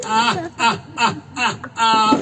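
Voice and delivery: hammed-up wailing half laugh, half cry; mock wailing. Karagiozis mocks the crier, wailing like a crybaby. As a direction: wail it out like a crybaby.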